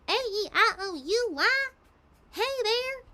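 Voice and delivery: high, swung, silly country twang; Applin country accent. Shown as a demonstration. Showing fans her Applin accent, silly country twang. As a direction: stretch each vowel like a cartoon country pony. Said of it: "exaggerated and very swung and very silly".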